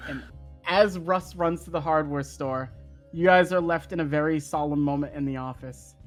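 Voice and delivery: ordinary talk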